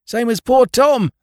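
high, loud frightened wail; panicked cry. Acted. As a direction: cry out remembering his friend. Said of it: "he gasped out".

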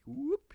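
a quick high yelp; imitated whoop. Imitating the kid's whoop again, high and lighter. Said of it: "He does his whoop sound".

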